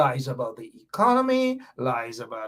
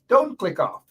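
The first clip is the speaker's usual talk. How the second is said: ordinary talk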